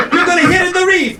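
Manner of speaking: high-pitched Italian warning; hammed-up Italian accent. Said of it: "That's an Italian accent".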